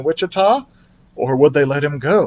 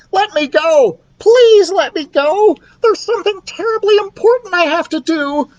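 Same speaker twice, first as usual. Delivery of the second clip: high, loud squeaky pleading; desperate mouse pleading; 4 dB louder than usual. Acted. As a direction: beg to be let out, frantic. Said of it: "he shouted through the glass in desperation".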